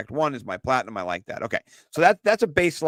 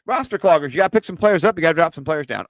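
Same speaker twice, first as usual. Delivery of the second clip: higher, louder announcer-style intro; segment intro hype; 6 semitones higher, 6 dB louder, faster than usual. Acted. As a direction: kick off the segment like a show host.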